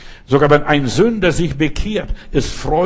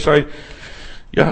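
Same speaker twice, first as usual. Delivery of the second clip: ordinary talk, nothing marked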